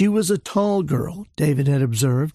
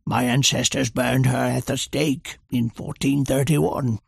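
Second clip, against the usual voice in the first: Churchill's loud whisper; 3 semitones lower than usual. A narrator's reading. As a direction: lean in and hiss it urgently. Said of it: "loudly whispered".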